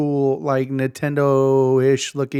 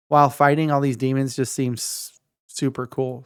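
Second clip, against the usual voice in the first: usual speaking voice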